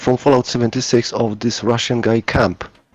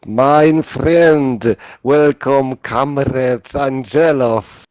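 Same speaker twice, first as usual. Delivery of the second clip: loud, higher mock-Russian greeting; big Soviet-Russian accent, slowing on the name; 4 semitones higher, 7 dB louder, much slower than usual. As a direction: hail a comrade in a hammed-up Russian accent. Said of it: "with the Russian Soviet talking".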